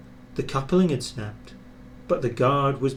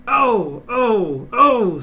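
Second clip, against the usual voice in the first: much higher, louder drawn-out protest; wailing trucks; 9 semitones higher, 8 dB louder, much slower than usual. Acted. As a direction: cry out like a row of grumbling trucks. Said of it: "said the trucks".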